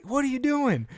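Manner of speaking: high, alarmed mock question to the baby; startled dad. Dad finding the baby halfway up the stairs, high startled yelp. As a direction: yelp it in disbelief. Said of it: "I'm like".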